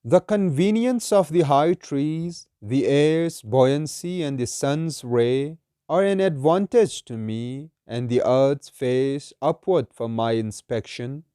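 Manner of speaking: slow, measured verse reading; solemn, deliberate poetry reading; poem recitation. Acted. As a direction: recite it slowly, drawing out each phrase.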